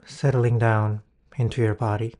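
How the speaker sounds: guided meditation. Acted. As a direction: ease the listener into calm.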